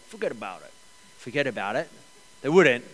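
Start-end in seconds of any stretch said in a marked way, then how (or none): 0.1-0.7 Italian-American. Retold or imitated. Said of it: "as Italians would say". 1.2-1.9 put-on New York accent. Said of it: "New Yorkers would say that".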